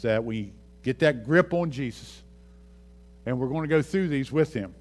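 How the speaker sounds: ordinary talk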